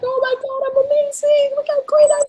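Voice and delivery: very high, gushing delight; squealing mock self-admiration; thrilled squeal. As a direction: mimic a client amazed at their own footage. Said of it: "they freak out".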